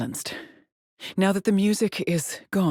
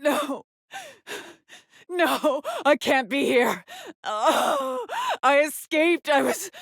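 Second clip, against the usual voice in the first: high, breathless disbelief; frantic denial between gasps; panicked; 9 semitones higher, slower than usual. Acted. Trapped woman realising her escape failed, panicked.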